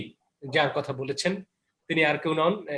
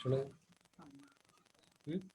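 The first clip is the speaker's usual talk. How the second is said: ordinary talk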